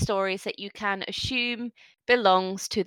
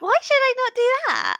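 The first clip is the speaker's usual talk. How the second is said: put-on high, pouting little girl with arms crossed; a child threatening to tell her mum; stubborn orc child; 11 semitones higher, 3 dB louder, faster than usual. Orc child crossing her arms at the adventurers, high and pouting.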